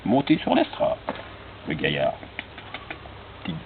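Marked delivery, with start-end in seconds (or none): 0.1-1.0 showman voice. Acted. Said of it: "il monte sur l'estrade".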